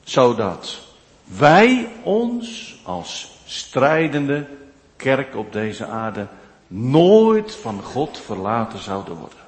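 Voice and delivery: ordinary talk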